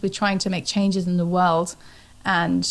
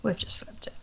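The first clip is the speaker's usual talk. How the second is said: whispered echo; 11 dB quieter than usual. Real, not acted. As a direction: whisper the words back. Echoes friend's line.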